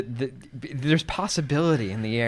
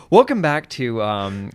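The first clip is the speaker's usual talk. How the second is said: podcast intro; 5 dB louder, slower than usual. Acted.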